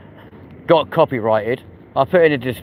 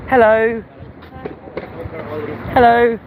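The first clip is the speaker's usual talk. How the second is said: sing-song hello; 9 semitones higher than usual. Real, not acted. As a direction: call it out to passers-by. Greeting strangers in passing.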